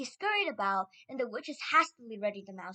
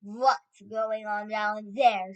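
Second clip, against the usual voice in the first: slow, loud Grand High Witch; commanding shout; 2 semitones lower, 9 dB louder, much slower than usual. A narrator's reading. Grand High Witch glaring down from the platform, shouting. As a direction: boom it out slowly from above. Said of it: "shouted the Grand High Witch".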